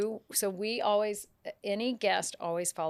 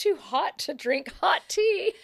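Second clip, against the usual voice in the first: excited realization; 11 semitones higher, 6 dB louder than usual. Retold or imitated. Voicing tea seller's bright idea.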